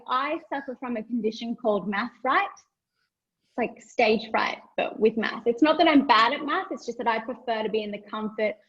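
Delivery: ordinary delivery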